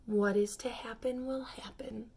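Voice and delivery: unvoiced whisper to himself; whispered under his breath, resigned. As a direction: murmur it, resigned, just breath. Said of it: "he repeated to himself".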